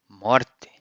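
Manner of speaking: Morte as MOR-tch; Portuguese morte. Shown as a demonstration. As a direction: model word Morte. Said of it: "we say morte".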